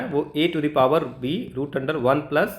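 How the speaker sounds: ordinary talk, nothing marked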